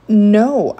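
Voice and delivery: high, loud distressed protest; upset child's outcry; about to cry. A narrator's reading. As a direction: plead against it, close to tears. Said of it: "now I was really about to cry".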